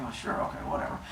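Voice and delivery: breathy dismissive whisper; whispered aside. Retold or imitated. Cronus humoring his wife under his breath, whispered. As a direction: whisper it, brushing her off.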